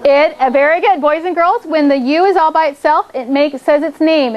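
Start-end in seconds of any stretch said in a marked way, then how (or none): none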